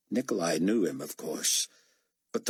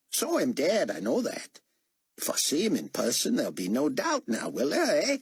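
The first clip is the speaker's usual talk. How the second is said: excited Australian driver, much higher; 6 semitones higher than usual. A narrator's reading. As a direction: gush about the resurrection like an awed Aussie fan. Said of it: "his accent making David guess he was an Aussie".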